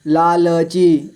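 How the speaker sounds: लालची slowly as ला, ल, ची; letter by letter. Shown as a demonstration. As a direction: sound the middle ल of लालची fully. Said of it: "थोड़ा fast पढ़ेंगे". (लालची को तोड़कर: ला, ल, ची)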